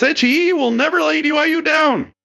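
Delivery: very high, odd-voiced rendering of a capitalized slogan; all-caps slogan. Commentator quoting a politician's capitalized endorsement, shrill all-caps voice. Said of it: "The capitalization is the only thing working harder".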